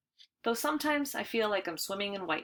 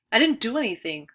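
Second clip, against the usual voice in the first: louder, higher, bursting out; blurted protest; 4 semitones higher, 7 dB louder than usual. A narrator's reading. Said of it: "I blurt".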